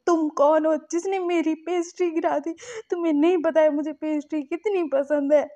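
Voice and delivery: high, loud, hurt whine over a dropped pastry; emotional. Retold or imitated. Lanson wailing at the stranger, emotional whine. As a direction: act out a wounded complaint. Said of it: "emotional होकर बोलता है".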